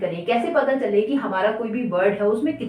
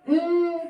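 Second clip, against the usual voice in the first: a single high hum; one short closed-mouth beat; humming one syllable; 8 semitones higher than usual. Shown as a demonstration. Teacher humming meet once for students. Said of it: "एक बार आपने हम किया है".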